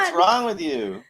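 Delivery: high, slow mock scolding; hammed-up disbelief; exaggerated scolding. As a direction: drag it out like a shocked aunt. Repeats scolding, drawn out.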